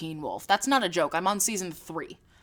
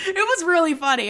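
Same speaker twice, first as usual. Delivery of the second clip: higher voice through real laughter; laughing; 8 semitones higher, 5 dB louder than usual. Real, not acted. Recalling a costume prank on Jake, laughing. Laughing at own Halloween story.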